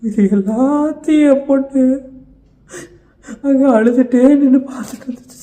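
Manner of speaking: loud, high, anguished telling; anguished outcry. Acted. Father telling sir his daughter watched crying, loud and anguished.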